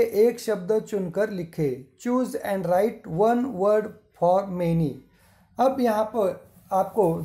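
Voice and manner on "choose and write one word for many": drawn out, word by word; slow dictation. Shown as a demonstration. Teacher reading the English question to the class, very slow.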